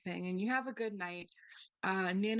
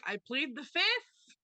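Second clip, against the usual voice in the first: grandma voice; 5 semitones higher, 5 dB louder, slower than usual. Retold or imitated. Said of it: "Nana's like".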